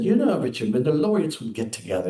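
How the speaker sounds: imitating an Irish woman's voice, badly; terrible Irish accent. Imitating Nobel laureate guest Betty Williams in a bad Irish accent. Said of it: "it's a terrible Irish accent here".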